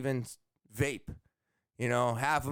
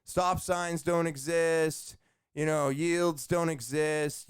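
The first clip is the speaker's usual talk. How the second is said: normal talking voice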